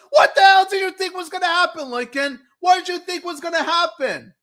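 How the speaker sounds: high, loud exasperated shout; exasperated yell. Retold or imitated. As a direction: yell it in disbelief. Mocking a character's blunder.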